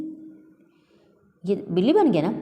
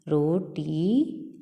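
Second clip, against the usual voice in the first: Roti stretched very slowly; slow teaching drawl on roti; drawn-out word; 3 dB quieter, much slower than usual. Shown as a demonstration. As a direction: stretch roti out for the class to copy.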